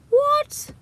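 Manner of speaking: squeaky high shocked yelp; shocked what. Real, not acted. Vlogger reading quake news, startled squeak. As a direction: blurt it out in disbelief.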